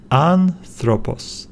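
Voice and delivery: "Anthropos" slowly, stress on AN, slight break after it; stress on first syllable. Shown as a demonstration. Teacher showing the accented syllable, slow and stressed. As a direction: lean on "An" in "Anthropos", drawn out. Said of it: "need to stress this syllable".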